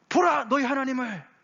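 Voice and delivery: high-pitched herald's cry. Acted. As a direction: proclaim it far and wide. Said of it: "그렇게 외치라는 거예요".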